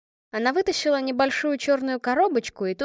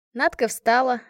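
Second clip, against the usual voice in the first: plain reading voice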